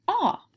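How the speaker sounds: "ah" alone, pitched well up; modelling the "ah" sound. Shown as a demonstration. Teacher showing pupils what the letters say, modelling "ah". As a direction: sound out a high, isolated "ah". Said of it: "This sound is spelled".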